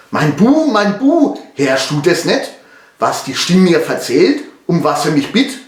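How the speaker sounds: mildly acted alarm, high cry to the son; alarmed father. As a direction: appeal to your boy with a touch of fear. (leicht gespielte Angst des Vaters)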